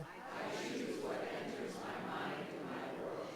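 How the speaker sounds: faint slow congregation repeat; distant group recitation; group echo. Real, not acted. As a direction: murmur it back together. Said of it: "you can repeat it together".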